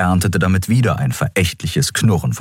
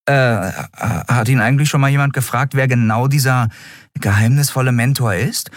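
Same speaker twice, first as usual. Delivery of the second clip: put-on hesitant young voice, a bit higher; cautious question; 8 semitones higher than usual. Miko timidly asks the crew about the mentor, cautious. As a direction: ask carefully, unsure if you should. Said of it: "fragte Miko vorsichtig".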